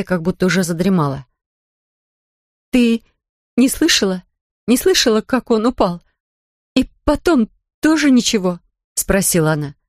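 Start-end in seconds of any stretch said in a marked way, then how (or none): 2.7-8.6 anxious. Acted. Anxious questioning in the dark.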